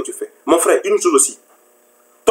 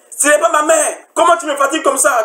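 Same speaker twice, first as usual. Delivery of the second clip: high-pitched mock exasperation; exasperated complaint; 10 semitones higher than usual. Spouse fed up with being pestered, mock exasperated.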